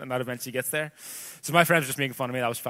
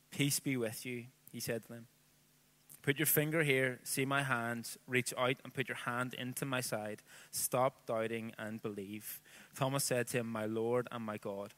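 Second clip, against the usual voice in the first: scripture reading; 8 dB quieter than usual. Acted. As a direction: read the passage reverently, letting lines settle. Reads the scripture passage aloud.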